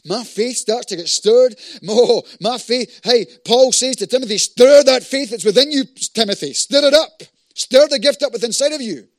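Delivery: loud, high, rousing exhortation; stirred-up preaching. Real, not acted. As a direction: stir them up at full force. Preaches at full force.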